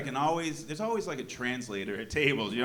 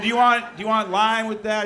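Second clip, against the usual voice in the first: mock bartender voice; 8 semitones higher, 10 dB louder than usual. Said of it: "They said".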